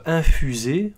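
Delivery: ordinary delivery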